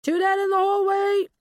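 loud, high call back; shouted reply. A narrator's reading. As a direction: report back at a shout across the house. Shouted reply from another room.

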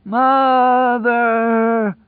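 high, loud, drawn-out call; long gleeful holler. A narrator's reading. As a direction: stretch it into a crazed joyful yell. Mad voice keeps calling happily.

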